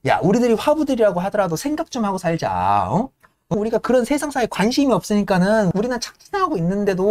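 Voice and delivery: lightly voiced fiery agitator; impassioned speech. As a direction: preach to the crew about their lot. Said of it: "열변을 토해도".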